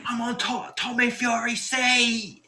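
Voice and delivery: high, slow mock shriek; mock screaming. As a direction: shriek it like the pushy fan claiming the seat. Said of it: "And all the way screaming".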